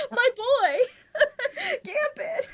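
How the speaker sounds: high, half-laughing mock wail; hammed-up heartbroken cry for her boy; mock grief. Grieving a killed character.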